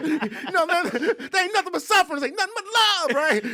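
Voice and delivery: very high, loud raving; hammed-up manic debate; acted crazy back-and-forth. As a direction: trade wild shouts, half laughing. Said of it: "we keep going back and forth".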